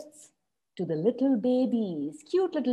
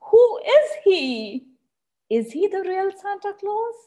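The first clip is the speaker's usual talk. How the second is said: wondering; 8 semitones higher than usual. Acted. Dino Babies wondering about fake Santa.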